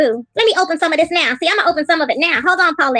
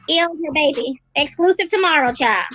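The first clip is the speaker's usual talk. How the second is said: ordinary talk, nothing marked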